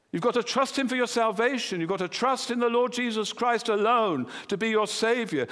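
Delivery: fervent preacher's appeal, pressing on; preaching at full force. Acted. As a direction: drive it home to the congregation. Sermon builds to exhortation.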